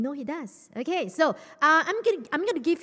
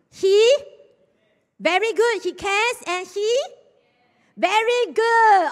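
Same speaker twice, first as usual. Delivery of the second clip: excited praise; 9 semitones higher, 8 dB louder, slower than usual. Real, not acted. Praising youths who answered.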